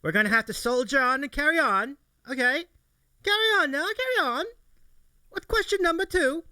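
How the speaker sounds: a bad British documentary narrator voice. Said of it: "narrate this like you're, uh, a British, uh, documentary narrator".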